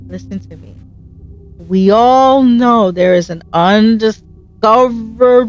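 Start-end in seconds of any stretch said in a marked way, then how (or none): 0.1-0.7 listen to me dropped almost to a murmur; soft urgent plea to the viewer; much softer. Shown as a demonstration. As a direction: pull it right down, soft and close. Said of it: "But listen to me".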